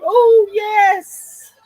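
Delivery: loud, high, delighted cheer; excited approval. Real, not acted. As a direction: cry out in keen agreement. Delighted reaction to Rizzo.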